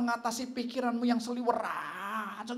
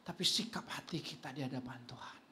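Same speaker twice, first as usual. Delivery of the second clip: hushed; 5 semitones lower, 6 dB quieter than usual. Real, not acted. Preacher drops to hushed point.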